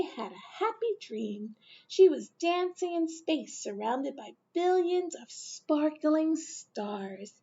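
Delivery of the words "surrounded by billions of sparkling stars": ordinary delivery, nothing marked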